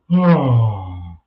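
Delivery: low, loud drawn-out oh; mock a streamer trailing into silence; mock dull streamer. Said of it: "and then he says".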